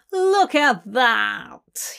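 slow, drawn-out snarl. A narrator's reading. Burgess to his captive as intruders walk in, snarling. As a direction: snarl the words out slowly. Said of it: "he snarls".

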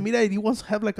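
ordinary delivery

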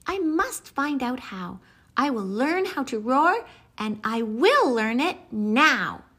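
high, eager little mouse voice; a tiny mouse making up his mind; high squeaky mouse resolving to be brave; small mouse. Acted.

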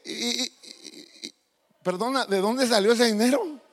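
can't get the words out from shock; flabbergasted dad stuttering; stunned stammer. Retold or imitated. Father stunned by son's savings.

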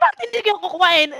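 high, loud, fast challenge; mock-irate team leader; retold angry retort. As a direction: fire back indignantly as the boss.

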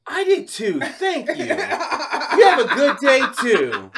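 high, drawn-out mock gushing lady; gushing caller. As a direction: exaggerate a delighted caller's goodbye, getting loud. Retold gushing lady on phone.

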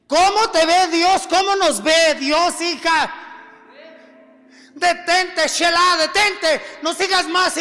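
fervent preaching; predicando. Acted. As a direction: preach it out with passion, half singing. Preaching at full force. (predicación ferviente)

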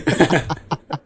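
short laugh; laughter. Real, not acted. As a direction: chuckle it out. Laughs at mishearing.

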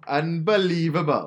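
loud, slow, high fake Welsh; Welsh accent. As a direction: stretch it out in a hammy Welsh accent. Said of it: "say things in a Welsh accent".

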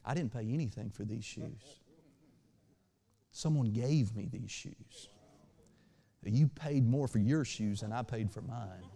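soft, low, gentle retort; sweetly. Retold or imitated. Pastor leans in to answer the rude man, sweetly. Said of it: "leaned in real sweetly and said".

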